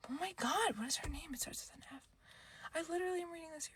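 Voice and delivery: soft whisper while thinking. Real, not acted. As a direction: whisper it to yourself. Searching memory for the name.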